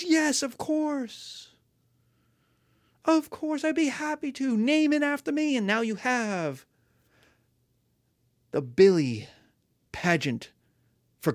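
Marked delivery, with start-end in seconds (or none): 0.0-5.5 high, slow, pleased patron voice; a vain local rich man happily agreeing; delighted rich guy. Acted. Rich guy accepts pageant request.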